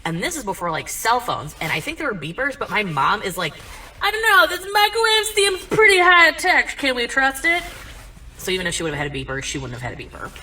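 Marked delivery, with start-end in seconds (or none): 4.0-7.6 loud, high mock-paranoid voice; hammed-up distrust, pitched way up; suspicious mom. Mom distrusts new technology, mocked.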